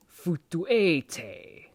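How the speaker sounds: futuete slowly spelled out syllable by syllable; slow careful demo. Shown as a demonstration. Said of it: "you would say".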